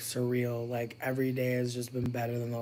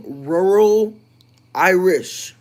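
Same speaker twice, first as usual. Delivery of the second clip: rural Irish; 6 semitones higher, 13 dB louder, much slower than usual. Acted. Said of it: "I was trying to give rural Irish".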